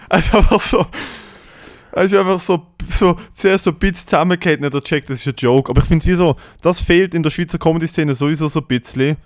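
usual speaking voice

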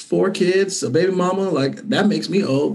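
ordinary talk

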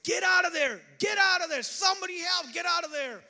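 high desperate shouts; frantic retold scream; screaming in a dream. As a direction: yell for the kids to flee. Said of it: "I was screaming".

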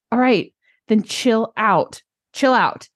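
slow, drawn-out coach telling her to relax; a slow mock coach easing her down; slow calming coach. Coach slowly telling an anxious client to chill out, calm and drawn out.

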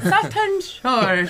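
"Sutton Shore" stretched out slowly in a put-on Scottish accent; laboured accent. Gnome retrying her hometown name "Sutton Shore", slow laboured Scottish accent. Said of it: "That's hard to say in this accent".

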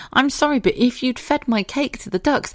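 ordinary talk

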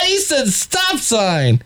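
high, hammed-up hilarity; mock morning zoo laugh. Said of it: "everybody else goes".

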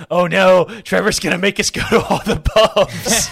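half-joking dread about the night ahead; mock dread. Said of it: "[name]'s clearly traumatized".

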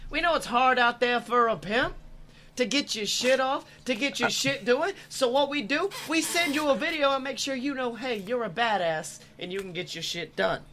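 hammed-up announcer plug; ad read. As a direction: sell it like a pitchman. Sponsor ad read.

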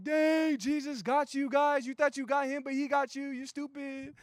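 ecstatic gloating. Pretend. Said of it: "like, ecstatic".

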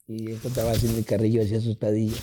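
ordinary talk

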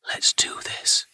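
breathy whisper, no voice; conspiratorial whisper before the dice roll. A narrator's reading. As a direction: hush it to a secret whisper. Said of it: "she whispered".

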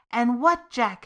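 dreadful question. A narrator's reading. Mother asking her son in dread, a touch anxious. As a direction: press him with a touch of dread. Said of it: "her eyes wide with dread".

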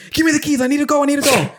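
put-on very high, urgent kid voice; frantic little brother. Little brother begging mom for the keys, frantic high mimicry. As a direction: imitate a desperate child begging, pitch shooting up.

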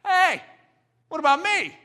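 high, loud cry for help; pleading outcry. Real, not acted. Preacher crying out for men to challenge him, high and loud. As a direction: call out for someone, please. Preacher cries out his own need.

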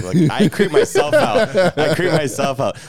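creepy voice. Pretend. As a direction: lightly ham up the creep. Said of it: "that impression I just did was really creepy".

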